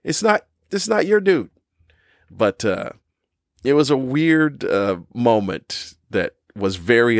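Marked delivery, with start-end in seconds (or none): 0.0-1.5 pitched well up in disbelief; incredulous retort. Retold or imitated. To the Canadian guy, pitched well up in disbelief. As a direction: say it as baffled outrage. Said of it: "I'm like".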